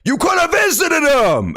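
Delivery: sudden high, loud yell of frustration; angry outburst. Real, not acted. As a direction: snap at the character in real anger. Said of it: "I got mad".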